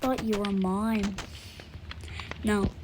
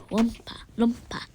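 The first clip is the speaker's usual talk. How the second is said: ordinary talk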